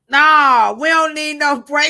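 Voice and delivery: loud, high shouted no; loud insistent refusal. Retold or imitated. Mimicking a student shouting at her teacher, loud. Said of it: "She said this".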